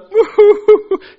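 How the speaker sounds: high, loud rapid hooting laugh; mock laugh. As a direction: hoot out a quick, high laugh. Imitates someone's reaction while telling.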